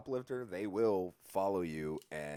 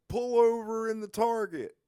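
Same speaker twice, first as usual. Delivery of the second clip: high, loud officer's order; retold shouted order; 9 dB louder than usual. Cop ordering the truck to pull over, retold shout. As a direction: bellow a mock police command up high. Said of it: "And he's like".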